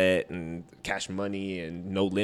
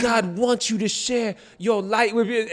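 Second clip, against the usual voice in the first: much higher, slow, louder cheering on; egging-on producer; 13 semitones higher, 6 dB louder than usual. Retold or imitated. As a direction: push him from behind, cheering. Persuading the rapper.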